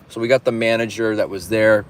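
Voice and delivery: ordinary delivery, nothing marked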